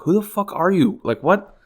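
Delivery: high, exasperated mock retort; a career employee snapping at a teenage intruder, high and incredulous; incredulous outburst. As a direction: fling it up high in disbelief. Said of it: "It's like".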